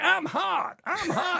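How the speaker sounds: very high, loud sassy brag; hammed-up diva voice; sassy Angela impression. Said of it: "And she's like".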